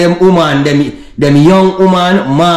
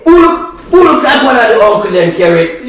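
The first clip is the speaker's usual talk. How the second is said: high indignant disbelief; outraged high protest; 9 semitones higher than usual. Real, not acted. Outraged denial of looking old.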